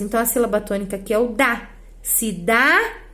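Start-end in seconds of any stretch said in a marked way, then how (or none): 2.0-3.0 "cidade" with a long, rising DA, then a detached de; stressed tonic syllable. Shown as a demonstration. Teacher pointing out the tonic syllable, "cidade" stressed on DA. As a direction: lean on the DA in "cidade", drawing it out. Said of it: "que é a pronunciada com mais força".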